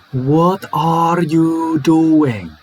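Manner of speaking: slow, full ARE and YOU in "What are you doing?"; careful full form. Shown as a demonstration. Teacher voices what learners think is said, slow careful full form. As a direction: spell out every word of "What are you doing?", drawn out. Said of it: "we say it like this".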